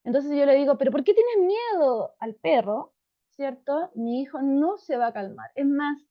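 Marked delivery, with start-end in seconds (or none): 0.8-2.1 scolding question. Retold or imitated. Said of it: "mi reto".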